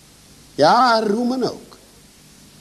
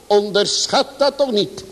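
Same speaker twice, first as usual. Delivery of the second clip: ordinary talk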